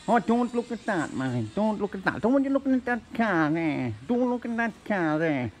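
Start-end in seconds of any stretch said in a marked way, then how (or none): none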